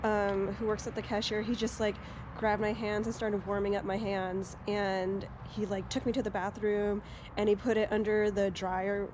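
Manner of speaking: soft, quiet storytelling; speaking softly; hushed. Real, not acted.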